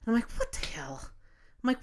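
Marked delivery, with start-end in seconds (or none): 0.3-1.0 breathy whispered what the hell; whispered aside. Retold or imitated. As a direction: whisper it under your breath, annoyed. Muttering a private reaction.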